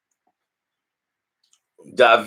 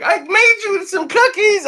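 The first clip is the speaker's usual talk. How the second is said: high squeaky mock girl voice; exaggerated falsetto of an eager girl; mimicking girls. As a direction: jump way up into a put-on girlish pitch. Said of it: "Girls be like".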